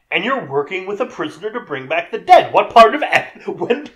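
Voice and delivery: increasingly high, incredulous rant; mock indignation. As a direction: argue back, building to a high, loud outburst. Acted retort to ethical objection.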